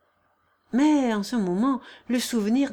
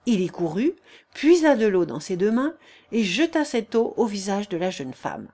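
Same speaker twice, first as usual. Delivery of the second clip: usual speaking voice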